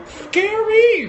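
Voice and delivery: high, squealed "scary"; playful fright. Pretend. Jokes that the child is a horror villain, mock fright.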